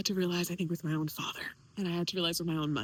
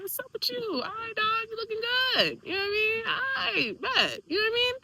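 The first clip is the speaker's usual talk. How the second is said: high, loud, hammed-up cool greeting; mock hip greeting; 14 semitones higher, 4 dB louder than usual. Daughter rehearses meeting her hip father, mock cool and high. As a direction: play it overly chummy, voice pitched way up. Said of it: "My father was a hip guy".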